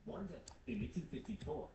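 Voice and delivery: ordinary talk, nothing marked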